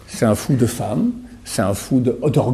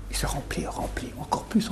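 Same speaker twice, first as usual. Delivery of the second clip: whisper; 4 semitones higher, 13 dB quieter than usual. Real, not acted. Whispered emphasis in sermon.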